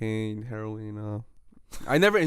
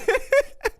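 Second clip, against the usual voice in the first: squealing high laugh. Real, not acted. Friend laughing at his own aspirin joke, high squeal. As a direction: burst out in a high giggle.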